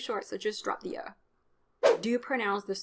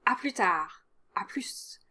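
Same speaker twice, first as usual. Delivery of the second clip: whispered French goodbye. Shown as a demonstration. Teacher whispers casual French farewells.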